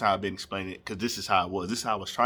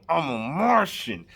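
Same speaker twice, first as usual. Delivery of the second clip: loud, slow, high yell; yelling into his cousin's ear at the club; retold drunken yell; 7 semitones higher, 4 dB louder, slower than usual. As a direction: belt it slowly like a drunk in a club. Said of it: "I'm yelling at him".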